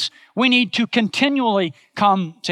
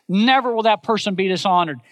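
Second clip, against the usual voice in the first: usual speaking voice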